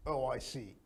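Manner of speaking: flat, quiet robot tone; robotic monotone. Shown as a demonstration. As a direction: say it with no feeling. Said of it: "You're not a robot".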